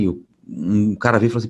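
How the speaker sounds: ordinary delivery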